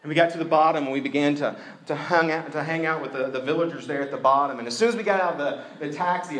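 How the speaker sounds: ordinary delivery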